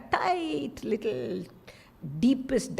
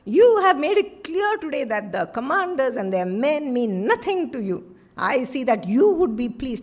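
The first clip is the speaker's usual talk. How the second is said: stern rebuke; 2 semitones higher, 6 dB louder than usual. Acted. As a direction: throw the accusation at him. Joab rebukes the king.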